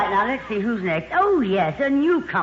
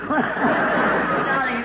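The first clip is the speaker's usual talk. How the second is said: drawn-out darling; 5 semitones higher than usual. Real, not acted.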